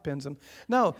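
ordinary delivery

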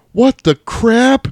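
slow, very high and loud squawk; high, loud, drawn-out shocked yelp; startled squeal. Retold or imitated. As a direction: shriek it like a shocked cop. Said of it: "the cop that's driving goes".

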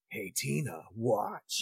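low, soft mock-villain call; non-modulated Robert Englund. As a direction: lightly imitate a quiet, low movie villain. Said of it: "basically Robert Englund's speaking voice".

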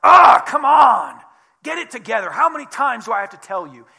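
loud, exasperated scolding; exasperated shout; impatient God voice. Retold or imitated. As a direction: snap at them like a fed-up boss. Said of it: "And God's like".

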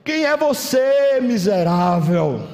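high opening, slow falling challenge; forceful challenge. Real, not acted. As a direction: stretch it out and let it drop. Preacher challenges the congregation.

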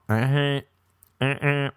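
short two-note honk; vocal car-horn imitation; car horn noise. Shown as a demonstration. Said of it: "a car horn".

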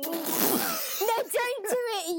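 slow laughing warning. Real, not acted. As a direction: laugh through the words.

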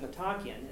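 Metachion said meh-TAH-kee-un, stress on TAH; corrected pronunciation. Shown as a demonstration. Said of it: "that's how you pronounce it".

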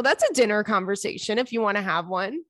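ordinary talk

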